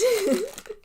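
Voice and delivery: quick high-pitched giggle; high laugh. Real, not acted. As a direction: burst into a little giggle. Laughs at biscuit explanation.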